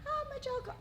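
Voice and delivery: high, squeaky, sing-song rainbow character. Pretend. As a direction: bounce it up high like a cheerful rainbow. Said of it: "You're not talking like a rainbow".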